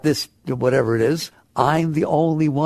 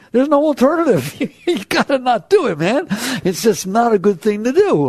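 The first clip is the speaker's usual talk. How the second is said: pitched up, loud, laughing insistence; emphatic; 8 semitones higher, 4 dB louder than usual. Real, not acted. Teacher insists to the audience, high and emphatic. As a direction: drive it home with a laugh.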